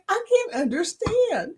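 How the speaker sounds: high-pitched mock whine of confusion; exasperated. Student retelling her struggle with teacher's accent, exasperated. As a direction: plead helplessly, imitating your baffled self.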